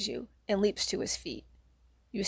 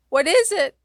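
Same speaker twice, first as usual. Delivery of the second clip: high, loud frightened scream; 9 semitones higher, 7 dB louder than usual. Acted. A girl screaming at the closet monster, high and loud. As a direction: scream in terror. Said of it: "a girl screams".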